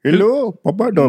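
hammed-up imitation of an Indian accent; mock Indian accent. Shown as a demonstration. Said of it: "Everyone does Indian accents".

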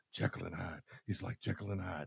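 very soft, low muttering; imitated barely audible grumble; Carla mumbling. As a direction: grumble under your breath. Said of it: "she's just mumbling".

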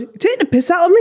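high, fast mock northern accent; quick, indignant northern English; high-pitched put-on northern accusation. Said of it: "but was a northern accent".

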